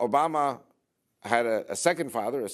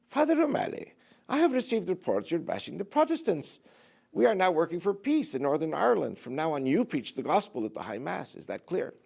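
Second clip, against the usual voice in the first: high-pitched, lilting Irish brogue; heavy Irish brogue; 7 semitones higher than usual. Acted. Archbishop reprimands Father O'Malley for bashing the Protestants, heavy Irish brogue. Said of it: "with his heavy Irish brogue".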